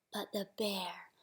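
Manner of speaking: hushed, sleepy storytime whisper; whispered refrain. A narrator's reading. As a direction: whisper so the bear stays asleep.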